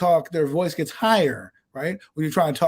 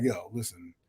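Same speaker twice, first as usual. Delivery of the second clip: low, subdued; 8 semitones lower, 11 dB quieter than usual. Acted. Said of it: "I'm trying to be like".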